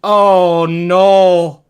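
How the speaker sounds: long, loud drawn-out groan of dismay; horrified realization. Retold or imitated. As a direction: let the mistake sink in, stretching each word. Realizes his awful mistake.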